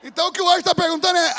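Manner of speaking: high, loud, fast preaching; preaching at full force. Acted. Preacher to congregation, fast shouted build-up. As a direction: build the tension toward the answer.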